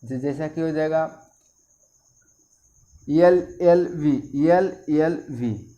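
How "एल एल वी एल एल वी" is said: letters spelled out very slowly, one by one; slow spelling. Shown as a demonstration. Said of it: "इसका उच्चारण क्या हो जाएगा?".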